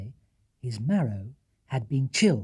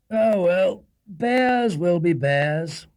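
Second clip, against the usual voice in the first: louder, very slow gruff husband; sleepy husband; 4 semitones higher, 7 dB louder, much slower than usual. Acted. Tired husband brushing off his wife's worry. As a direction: drag it out sleepily.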